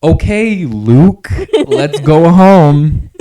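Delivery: loud, slow, high and drawn-out; hammed-up parent giving in to the name; resigned parent. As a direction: parent sighing out "Luke" with a laugh. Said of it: "they'd come up and be like".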